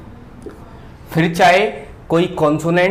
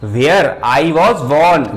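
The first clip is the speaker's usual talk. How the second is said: Where I was born spelled out, word by word; dictating English for students; very slow example sentence; 2 semitones lower, much slower than usual. Shown as a demonstration. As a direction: drag each word for the class to write. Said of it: "मैंने एक sentence बोला".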